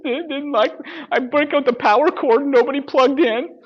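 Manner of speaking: high whining self-pity, slowed; whiny mock voice. Speaker mocking a realtor sulking in the corner, high and whiny. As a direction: whimper it like a rejected child.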